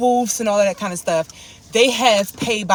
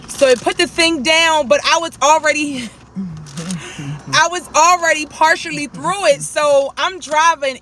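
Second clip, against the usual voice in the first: excited; 9 semitones higher, 4 dB louder than usual. Real, not acted. Tells the toll barrier mishap.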